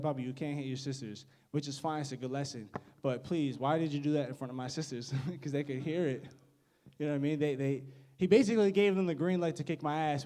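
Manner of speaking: ordinary delivery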